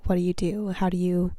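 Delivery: usual speaking voice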